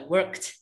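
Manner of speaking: "worked" as one syllable ending in KT; correct worked. Shown as a demonstration. Teacher modelling the right ending, one-syllable "worked". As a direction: close "worked" on a crisp T. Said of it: "tapi pakai".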